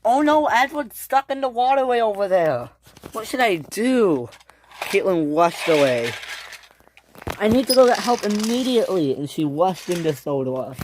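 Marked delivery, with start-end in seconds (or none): 0.0-4.3 high, worried exclamation; acted dismay in a raised voice; alarmed. Finds Edward stranded, alarmed. 7.4-9.1 fast, high and urgent; acted panic, quick and pitched up; urgent alarm. Rushing off for help.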